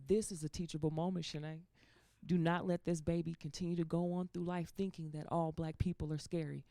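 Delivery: low, soft, measured elder voice; grandmother. Retold or imitated. Grandmother advising on a teachable moment, low and soft. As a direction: lecture gently and low, like a grandmother. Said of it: "I could hear my grandmother say".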